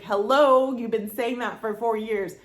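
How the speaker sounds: loud, high stretched Hello, then calmer; emphatic Hello. Retold or imitated. Friend repeating her point, emphatic Hello. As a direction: belt the Hello like it's obvious. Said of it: "And she's like".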